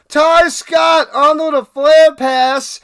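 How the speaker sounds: loud, high thrilled recap; excited play-by-play. Acted. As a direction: keep the roar going through the run.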